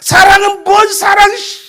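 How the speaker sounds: put-on loud, high scoff from a sulking child; angry retort. Child rejects mother's talk of love, loud and angry. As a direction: snap back in hurt disbelief.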